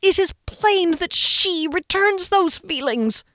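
high, slow, strained insistence; tight-throated. A narrator's reading. Friend revealing she feels the same, tight-throated. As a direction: strain each word through a tight throat. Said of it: "he said with a tight throat".

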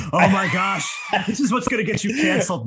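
high, loud laughing exclamation; laughing shout. Real, not acted. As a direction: shriek it out, cracking up. Laughing shock at host's opinion.

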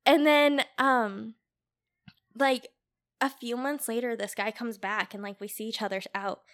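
usual speaking voice

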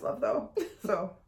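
ordinary delivery

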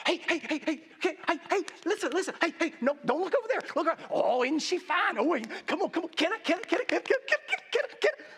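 insistent, high nagging that climbs at the end; pestering demon. Acted. Acting demons pestering for access.

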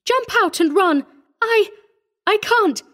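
high, desperate, faltering cry; panicked scream. A narrator's reading. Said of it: "Missus Ellison screamed".

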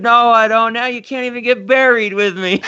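hammed-up weepy protest; imitating Howard. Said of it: "where he's like".

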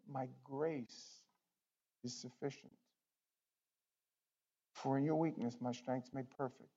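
soft solemn scripture quote; hushed. Real, not acted. Quiet reverent quoting of scripture.

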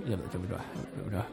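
low, soft, helpless aside; quietly at a loss. Acted. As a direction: say it helplessly under the breath. Helpless onlooker of the quarrel. (lavmælt og rådvildt)

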